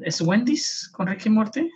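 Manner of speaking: high, doubtful asking tone; unsure question. Real, not acted. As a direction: ask it as if unsure. Unsure, checking the ad's name.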